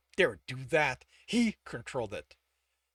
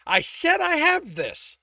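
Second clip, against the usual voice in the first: much higher and louder, slow and forceful; angry shout; 11 dB louder, slower than usual. A narrator's reading. Tibbs refusing to take a break, angry shout. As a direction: shout him down.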